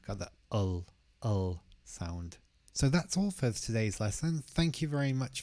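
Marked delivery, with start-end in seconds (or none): 0.5-2.4 ul said as a dark held l; dark l sound. Shown as a demonstration. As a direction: model the ul of Wolves. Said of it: "that's quite tricky sound".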